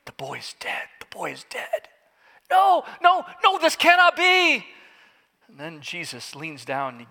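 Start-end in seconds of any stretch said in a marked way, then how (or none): 0.0-1.9 whispered murmur; murmuring crowd. Acted. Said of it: "crowd begin to murmur". 2.5-4.7 high, loud cry of denial; anguished denial. Acted. Father's horrified denial.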